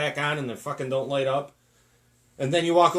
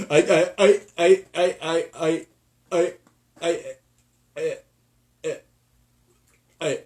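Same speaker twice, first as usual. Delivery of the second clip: put-on stammering old politician; 2 semitones higher, 5 dB louder, slower than usual. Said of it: "can't even remember what he did".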